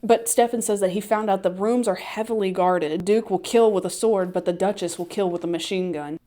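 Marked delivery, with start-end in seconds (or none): none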